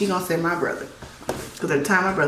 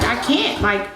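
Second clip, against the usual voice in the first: distressed plea; 9 semitones higher than usual. Retold or imitated. Pinned under table, pleading.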